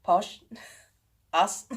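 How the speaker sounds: loud, disbelieving "Posh? Us?"; incredulous. Retold or imitated. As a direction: play it like the idea is absurd. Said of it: "And I'm sitting there like".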